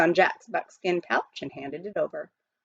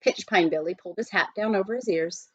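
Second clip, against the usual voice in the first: ordinary delivery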